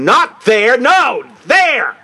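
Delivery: retold armed officer shouting; imitated yelling. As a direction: snap directions at her.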